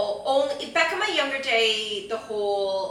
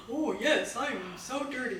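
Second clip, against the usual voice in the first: sexy voice; 6 dB quieter, slower than usual. Pretend. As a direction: play it sultry and a bit hammy. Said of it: "I hope that's not your sexy voice".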